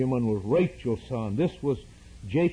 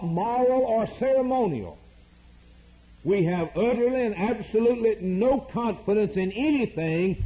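rising, higher preacher's litany building to a peak; preaching at full force; 5 semitones higher than usual. Acted. Preacher to congregation, climbing litany of no confidence. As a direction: hammer each line home, climbing higher.

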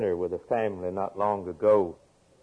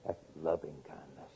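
much softer, lower, tender; hushed; 7 semitones lower, 15 dB quieter than usual. Real, not acted. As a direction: drop it almost to a hush. Preacher dropping to hush.

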